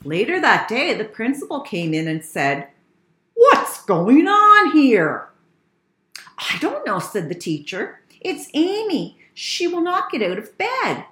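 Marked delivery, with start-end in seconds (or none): 3.3-5.3 loud, high, slow authoritative question; demanding. Acted. Principal demands an explanation. 6.4-7.0 high-pitched helpless reply; teacher's high, acted bafflement. Teacher answers the principal helplessly. 8.2-11.0 high-pitched complaint; teacher's high, acted bafflement; helpless. Teacher explains helplessly to principal.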